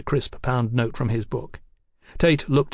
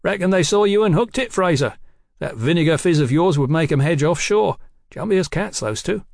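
higher, louder streetwise informant; cheeky rogue detective; 7 semitones higher, 5 dB louder than usual. Acted. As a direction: spill the news with cocky relish, pitched up. Voices Tate teasing Fraser.

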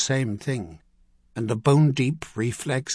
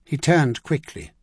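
ordinary delivery

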